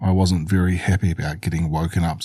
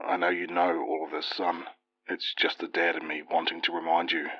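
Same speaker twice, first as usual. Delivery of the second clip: soft caring father; 9 dB quieter than usual. Acted. Dad on the phone, caring.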